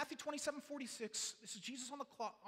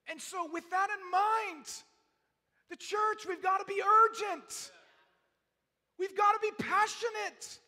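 shouting, higher and much louder; rally the church to urgency; full-force revival preaching at the congregation; passionate preaching; 6 semitones higher, 8 dB louder than usual. Real, not acted.

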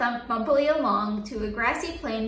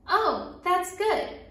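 slow pleased; 5 semitones higher, much slower than usual. A narrator's reading. Refrain, slow high.

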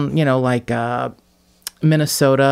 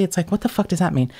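usual speaking voice

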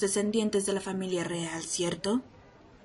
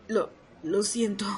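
hesitant whisper. Acted. Refusing an order, hesitant.

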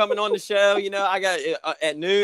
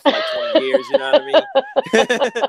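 laughing through the words, much higher; 10 semitones higher, 5 dB louder, slower than usual. Real, not acted. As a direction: crack up while saying it. Laughing at own joke.

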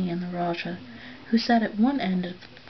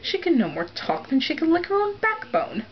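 put-on high, sneering old woman's voice; scornful old aunt; 9 semitones higher, 3 dB louder than usual. A narrator's reading. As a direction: scoff like a sour old aunt.

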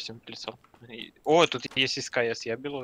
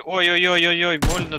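loud, higher ouch-ouch cry; pained yelp; 5 semitones higher, 9 dB louder than usual. Real, not acted. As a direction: yelp as if hit. Player getting shot in game.

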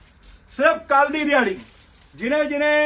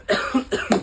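real cough; 3 semitones lower, 3 dB quieter than usual. Real, not acted. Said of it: "ਖੰਘ ਆਉਣ ਲੱਗ ਪਈ".

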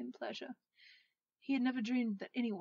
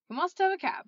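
high, loud exclamation; bubbly, raised voice; gleeful insisting; 8 semitones higher, 12 dB louder than usual. Acted. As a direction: announce it loudly with delight.

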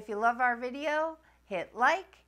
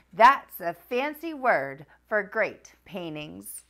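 lively picture-book read-aloud voice; reading to children; 4 dB louder than usual. A narrator's reading. Narrator reading Fancy Nancy to young viewers, animated read-aloud. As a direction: read it like bedtime story time for kids.

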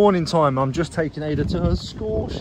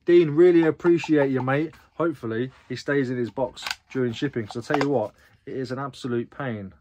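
ordinary delivery

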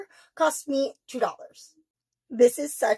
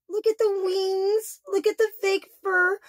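overwhelmed by cuteness; 7 semitones higher, slower than usual. Real, not acted. Gushing over bat duck.

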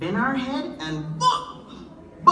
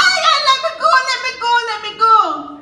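very high, loud frantic plea; panicked pleading; 12 semitones higher, 8 dB louder, faster than usual. Acted. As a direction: yank free, panicking. Anansi stuck, begging to be freed.

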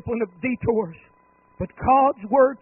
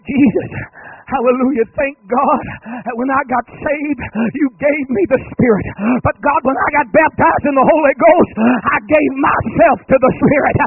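loud, higher, exultant praise; fervent loud testimony; 5 semitones higher, 12 dB louder than usual. Retold or imitated. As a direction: call it out like a joyful believer. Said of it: "where you say".

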